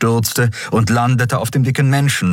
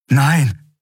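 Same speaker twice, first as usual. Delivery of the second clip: higher-pitched cry of refusal; dismayed cry; 6 semitones higher than usual. A narrator's reading. Captain sees Miko lying smoking, dismayed cry.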